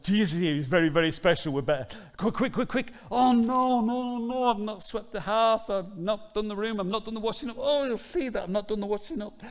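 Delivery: high, loud, frantic fussing; panicked Martha. Retold or imitated. Preacher acting Martha fretting over chores. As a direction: race about like a hostess in a panic. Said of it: "she probably just thought".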